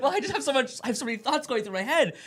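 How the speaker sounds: high, loud laughing protest. Retold or imitated. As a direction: laugh through the words. Laughing retelling her own reply.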